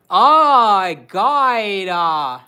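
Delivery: put-on very loud, drawn-out valley girl drawl; mock American girl. Husband mimicking her American girl act, loud valley drawl. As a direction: exaggerate an American girl, stretching every vowel. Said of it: "talk like this".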